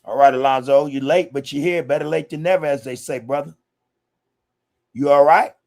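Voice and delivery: loud, high call to a latecomer; big happy welcome; hyped greeting. Real, not acted. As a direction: hail your buddy as he walks in. Host hails latecomer Alonzo.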